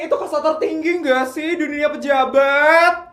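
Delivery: high, loud envious gushing; gushing envy. Acted. Friends gushing that they want to marry Rafin too, envious. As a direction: gush and squeal with envy. Friends gush over the match.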